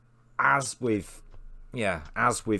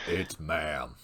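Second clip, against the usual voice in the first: low, rumbling put-on bass; extremely deep bass voice; 8 semitones lower, 6 dB quieter than usual. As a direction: drop the voice as low as it goes. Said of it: "In the deepest voice I can".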